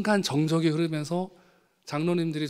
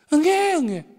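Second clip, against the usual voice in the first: high, louder mock baby wail; baby crying; 12 semitones higher, 7 dB louder than usual. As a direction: wail like an infant instead of answering. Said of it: "대답을 안 해요". (대답 대신 아기처럼 높게 우는 흉내)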